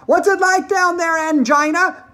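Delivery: high, loud long-distance call. Acted. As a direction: holler to your wife down a hole. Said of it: "he called out".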